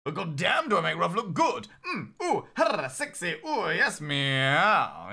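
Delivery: preening at himself in the mirror; purring with smug vanity; vain self-admiring. Pretend. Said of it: "and go".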